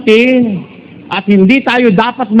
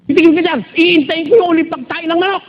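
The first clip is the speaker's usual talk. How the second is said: higher-pitched mock yell at a husband; mock wife yelling; 5 semitones higher than usual. Wife scolding husband woken at dawn, mock yell. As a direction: playfully act out the wife's scolding. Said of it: "Oh, sigawan".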